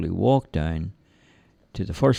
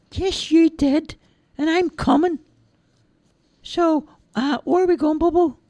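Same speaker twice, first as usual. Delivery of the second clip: put-on high, childlike simple lad voice; cheerful high-pitched lad; Charlie's voice; 5 dB louder than usual. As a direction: play the big innocent lug, high and eager. Charlie insisting on coming.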